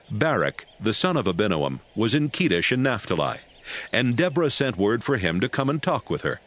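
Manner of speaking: ordinary delivery, nothing marked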